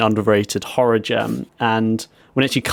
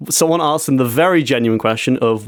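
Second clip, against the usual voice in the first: ordinary talk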